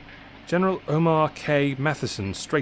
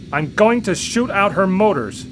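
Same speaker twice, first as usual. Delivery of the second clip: higher, louder yell into the radio; 8 semitones higher, 7 dB louder than usual. A narrator's reading. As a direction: shout the plan over the noise. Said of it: "Scott yelled into the radio".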